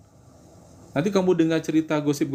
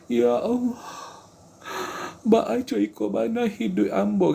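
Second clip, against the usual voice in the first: high, put-on complaining plea; mock whiny prayer; 7 semitones higher than usual. Mocking people who pray only to complain, high whiny voice. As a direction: imitate a wailing, self-pitying prayer. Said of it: "berdoanya meminta, menangis-nangis".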